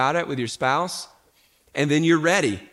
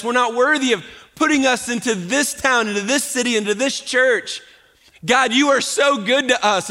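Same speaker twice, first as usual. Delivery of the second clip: passionate preaching; 5 semitones higher than usual. Acted. Pastor crying out to God before the church, fervent. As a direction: pour it out in praise.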